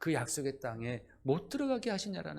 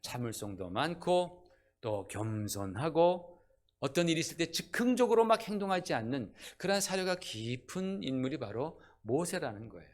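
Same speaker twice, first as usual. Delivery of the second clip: usual speaking voice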